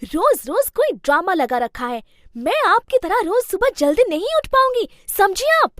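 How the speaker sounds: loud, high furious daughter-in-law; angry shout. Acted. Bahu storms out and yells at her mother-in-law, furious. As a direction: yell back at the nagging mother-in-law. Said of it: "Anjali गुस्से से बाहर आती है".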